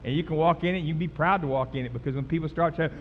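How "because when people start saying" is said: ordinary talk, nothing marked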